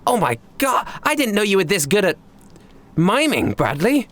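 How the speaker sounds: high-pitched, wonderstruck character voice; Tiger in awe. Acted. Tiger marvels at Bradley's miming, awestruck. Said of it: "he looks on at awe at you and he goes".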